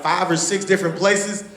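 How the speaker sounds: ordinary talk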